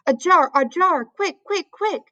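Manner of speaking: high and urgent, the giant calling for a jar; urgent high excited cry. A narrator's reading. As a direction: hurry her, voice leaping up. BFG urgently calls for a jar.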